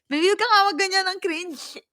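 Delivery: high, loud, fast squeal; squealing protest. Real, not acted. (tumitiling pagtutol)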